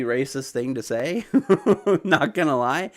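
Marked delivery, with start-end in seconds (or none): 1.3-2.0 short, higher-pitched chuckle; amused laugh. Real, not acted. Laughs at a chat comment. 2.0-2.9 said through a light laugh. Real, not acted. Laughs repeating the chat comment.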